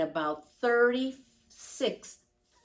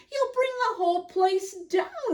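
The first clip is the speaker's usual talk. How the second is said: much higher, quick and laughing; 12 semitones higher, faster than usual. A narrator's reading. Harry laughing at the bear exercising in the tent. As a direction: tease her fondly, laughing. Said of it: "he said with a laugh".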